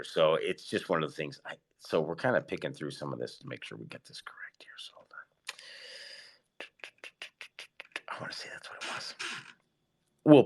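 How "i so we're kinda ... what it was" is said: whispered aside while searching notes. Real, not acted. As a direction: breathe it quietly to yourself.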